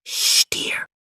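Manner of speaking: hushed whisper; mother whispering to her son in bed. Acted. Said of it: "she whispered".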